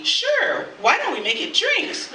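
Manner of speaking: high-pitched, bouncy imitation of a phone reply; eager agreement. Calling about coffee on arriving, imitated eagerness. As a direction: leap high on each eager answer.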